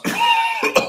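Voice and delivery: loud, sudden real coughing; coughing fit. Real, not acted. [name] interrupts his own show introduction, coughing fit. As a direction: cough hard right through the introduction. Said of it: "super embarrassing and really bad timing".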